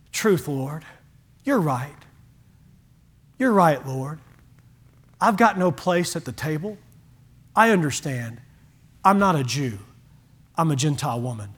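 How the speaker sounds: low, soft, humble acceptance; humble Gentile woman admitting her place; humble reply. Retold or imitated. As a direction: answer meekly, conceding.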